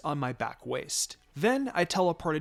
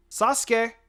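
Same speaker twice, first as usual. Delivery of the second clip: loud, raised call of the name; 8 semitones higher, 7 dB louder than usual. Acted. Sitter spots the lost boy and calls him loudly. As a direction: call across to the lost child.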